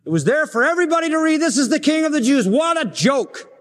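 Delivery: high, fast scornful scoff; sarcastic high mock. Real, not acted. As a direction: scoff at the sign's mockery. Mocking the sign.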